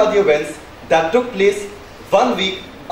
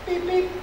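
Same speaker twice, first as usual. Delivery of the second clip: mock phone beeps; 8 semitones higher, 9 dB quieter than usual. Dad imitates phone tones.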